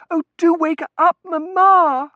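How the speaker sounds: high, loud daughter's plea; distraught pleading. Acted. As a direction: beg her loudly to come round. Daughter pleading with fainted mother.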